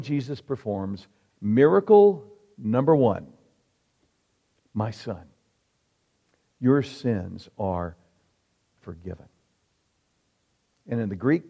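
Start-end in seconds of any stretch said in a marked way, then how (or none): 4.7-9.3 tender. Acted. Jesus's words voiced softly.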